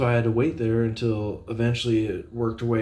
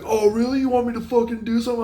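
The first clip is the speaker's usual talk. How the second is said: much higher and louder, fast mock challenge; angry challenge; 13 semitones higher, 6 dB louder, faster than usual. Imitating the customer daring him to fight, shouted. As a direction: throw the customer's challenge back, hammed up. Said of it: "He's like".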